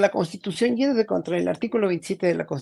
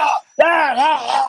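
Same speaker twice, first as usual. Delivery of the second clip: loud mock shouts, pitched higher; imitating Madero's shouting; 7 semitones higher, 7 dB louder, much slower than usual. Recalling the Senate uproar, loud imitated shouts. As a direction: belt out a hammed-up senator's yell. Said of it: "cómo gritaba Madero".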